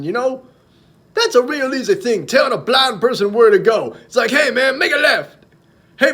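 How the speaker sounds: high, loud, shouted stand-up delivery; punchy, high-pitched comic rant; Chris Rock. Retold or imitated. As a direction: holler it like a riled-up comedian. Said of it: "This one is from Chris Rock".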